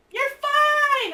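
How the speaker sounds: high, drawn-out dismissive call; calling back reassurance. Retold or imitated. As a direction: wave off the worry from afar. Acting partner calling back reassurance.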